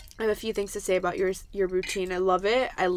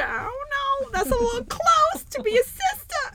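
very high, squeaky mock doubt; skeptical squeal. Judging a dating profile photo, high squeaky mock doubt. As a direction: squeak out your suspicion in falsetto. Doubts that girl is his sister.

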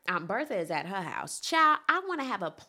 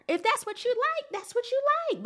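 much higher, sing-song emphasis; emphatic high. Real, not acted. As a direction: lift it up high and insist. Emphatic repeated phrase.

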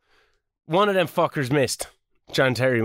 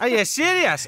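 Scottish accent; 7 semitones higher than usual. Shown as a demonstration. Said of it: "now we can do it in Scottish".

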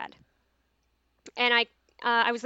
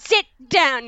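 a louder, firm classroom command; teacher voice; 3 semitones higher, 8 dB louder than usual. Retold or imitated. Telling the burglar teen to stay put, a louder teacher voice. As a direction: order him down like a teacher. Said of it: "My teacher voice came out".

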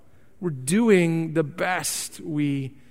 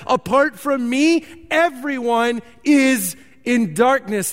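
higher, slow and weighty, each word placed; slow emphatic quote; 4 semitones higher, slower than usual. Acted. Preacher quotes Jesus to the congregation, slow and emphatic. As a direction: lay down Jesus' words with weight. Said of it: "Jesus says".

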